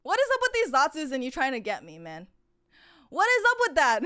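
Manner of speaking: loud, exasperated complaint; exasperated outburst. Real, not acted. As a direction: throw up your hands at chat. Exasperated at chat's acrostic.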